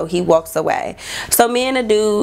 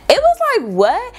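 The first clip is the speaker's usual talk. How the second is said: incredulous. Retold or imitated. Baffled at his reaction.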